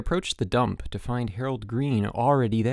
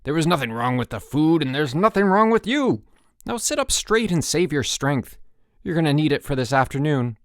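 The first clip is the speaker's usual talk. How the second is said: louder, higher, emphatic telling-off; angry scolding; 5 semitones higher, 5 dB louder than usual. A narrator's reading. Chip orders Rudy to save his strength, angry scolding. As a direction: scold hard, hitting each order. Counselor scolds the boy after growling.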